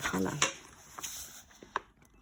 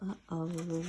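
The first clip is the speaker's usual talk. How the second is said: soft uh-oh; 8 dB quieter than usual. A narrator's reading. Heading for the moat.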